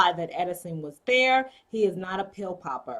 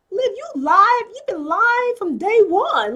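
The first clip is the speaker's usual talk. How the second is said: much higher and louder, slow; real exasperated outburst; 11 semitones higher, 8 dB louder, slower than usual. Real, not acted. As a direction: call her out, fed up. Fan calls out hypocrite character.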